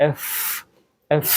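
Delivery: "এফ" with a long hissing English f; correct letter F. Shown as a demonstration. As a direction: hold the final f of "এফ" as friction. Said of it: "এই উপরের দাঁত". ("এফ"-এর শেষে দাঁত-ঠোঁটে লম্বা ফ্ ধ্বনি)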